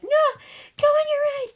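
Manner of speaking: very high, loud mock wail; too upset to talk. Wife sending husband off to his friends, hammed-up tearful wail. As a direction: squeal it through pretend sobs. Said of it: "I couldn't talk because I was just so upset".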